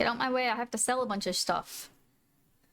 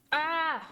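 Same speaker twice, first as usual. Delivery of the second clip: cute squeal; 6 semitones higher than usual. Real, not acted. Squeal at the cat.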